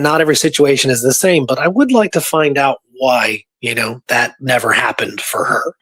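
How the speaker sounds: ordinary talk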